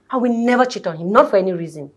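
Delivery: loud, defiant declaration; emphatic vow. Acted. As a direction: swear loyalty forcefully, no room for doubt. Wife defiantly declares loyalty to husband.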